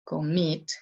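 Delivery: "commit" with weight on "mit"; stress on second syllable of "commit". Shown as a demonstration. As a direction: lean on the final syllable of "commit". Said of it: "más fuerte que la primera". (acento en la segunda sílaba de "commit")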